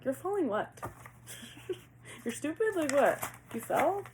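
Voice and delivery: high, incredulous questioning; real irritation. Real, not acted. Reader questions an unclear card message, irritated and incredulous. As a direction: fire off baffled questions, pitched high. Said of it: "I don't know why I'm getting so irritated".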